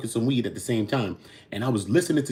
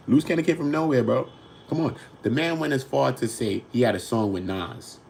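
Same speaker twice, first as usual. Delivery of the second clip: usual speaking voice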